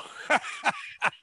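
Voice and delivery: a sudden high-pitched burst of laughter. Real, not acted. Laughing high as he pities the sponsor, amused. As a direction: let a laugh jump up high.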